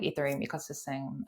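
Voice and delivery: ordinary delivery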